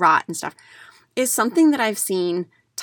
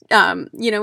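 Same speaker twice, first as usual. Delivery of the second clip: ordinary delivery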